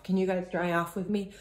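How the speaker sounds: ordinary delivery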